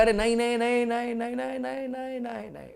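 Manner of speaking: high, pleading voice of the conscience, fading away; conscience saying no. Acted. Conscience pleading with the teacher not to do it. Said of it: "मेरा आवाज कर रहा है".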